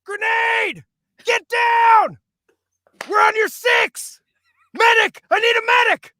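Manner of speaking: high, urgent battlefield shouts; video game soldier yelling. Acted. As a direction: bark combat callouts at full voice. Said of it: "You might know these lines".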